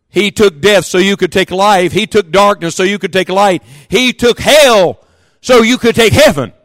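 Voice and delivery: triumphant pulpit climax; preaching cadence. Acted. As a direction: hammer each contrast harder.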